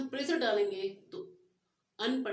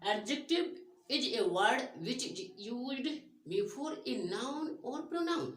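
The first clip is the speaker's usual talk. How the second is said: slow, spaced definition reading; slow dictation; 3 semitones higher, much slower than usual. Shown as a demonstration. Teacher dictating a grammar definition slowly.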